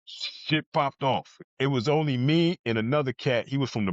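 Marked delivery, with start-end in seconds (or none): 0.1-1.4 slow emphasis. Real, not acted. Repeating prison violence point.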